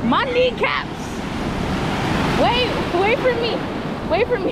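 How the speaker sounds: high squeals at the cold water; shrieking at the cold. Real, not acted. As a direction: yelp as the cold water hits. Stepping into cold sea water.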